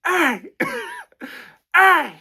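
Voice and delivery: very high, loud yelling; angry shout. Retold or imitated. Surly old man yelling at the mover, loud shout. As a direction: shout across the street at the mover. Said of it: "and I hear".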